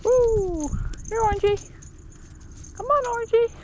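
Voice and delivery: high, bright calling to a cat. Real, not acted. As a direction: call the kitty up high.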